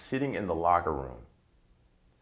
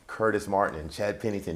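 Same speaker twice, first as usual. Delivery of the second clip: normal talking voice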